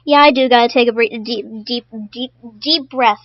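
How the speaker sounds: ordinary delivery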